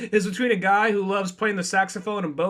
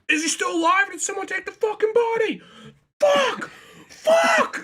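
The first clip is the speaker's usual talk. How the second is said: hammed-up high-pitched panic, climbing higher; intense drama; 13 semitones higher than usual. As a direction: build to frantic, shrieked swearing, played for laughs. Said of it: "this intense, like, drama".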